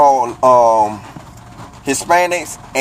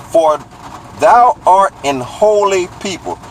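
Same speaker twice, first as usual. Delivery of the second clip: each word read slowly and deliberately; 6 semitones higher, slower than usual. Shown as a demonstration. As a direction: read it slow so they can follow. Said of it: "I'm gonna read it slower".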